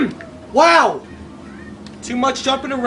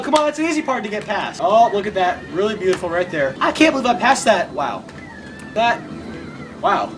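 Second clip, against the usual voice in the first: usual speaking voice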